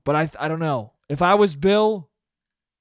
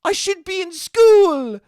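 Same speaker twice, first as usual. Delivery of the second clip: high, slow, earnest schoolgirl voice; mock-earnest young activist; Greta impression; 11 semitones higher, 5 dB louder, slower than usual. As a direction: pitch it way up and drag it out like a sincere teen. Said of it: "and goes".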